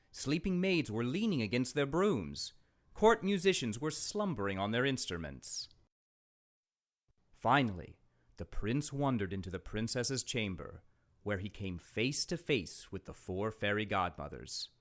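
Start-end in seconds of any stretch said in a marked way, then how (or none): none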